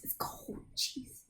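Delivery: breathless whining complaint; annoying whiny voice. Acted. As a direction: shiver and whine about the cold. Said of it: "very annoying voice".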